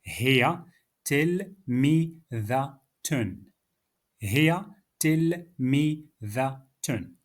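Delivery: "Hiya tilmidatun" drawn out slowly, every syllable clear; patient, slow teacher's demo; slow model. Shown as a demonstration. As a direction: model it slowly for students to repeat. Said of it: "Repeat after me".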